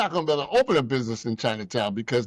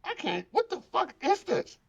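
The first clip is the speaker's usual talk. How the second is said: a hammed-up gasp of disgust; shocked at the buffet; 3 semitones higher than usual. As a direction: recoil at the strange food. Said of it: "was like".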